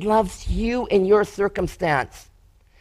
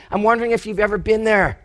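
ordinary delivery

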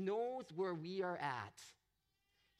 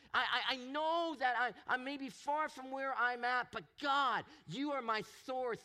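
higher and louder, building to a bold declaration; rising faith; 5 semitones higher, 4 dB louder than usual. Retold or imitated. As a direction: shout your trust over the crowd. Psalmist's prayer rising in faith.

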